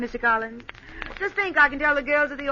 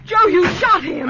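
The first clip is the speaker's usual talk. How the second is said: loud, high, slow cry of horror; stunned and terrified, crying out; horrified shout; 7 semitones higher, 7 dB louder than usual. Acted. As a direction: shout it in disbelief. Horrified after the shooting.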